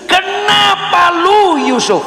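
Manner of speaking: high, slow angry shout at Joseph; angry father. Acted. Outraged father shouting at Joseph about pregnancy. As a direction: roar the question at him like an outraged dad.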